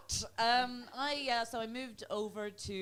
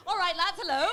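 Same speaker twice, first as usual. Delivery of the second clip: punchier, louder hello; louder hype greeting; 11 semitones higher, 11 dB louder than usual. Shown as a demonstration. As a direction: greet them with real energy. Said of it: "Let's try that again".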